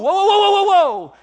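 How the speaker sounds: high, loud alarmed whoas. Retold or imitated. Preacher imitating his own warning, loud and alarmed. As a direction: yell to stop someone grabbing a knife. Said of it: "I'm always going".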